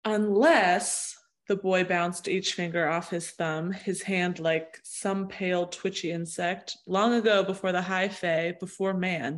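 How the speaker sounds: quiet storybook reading voice; epic fantasy narrator. Acted. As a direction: read it like a fantasy audiobook. Reads book passage aloud.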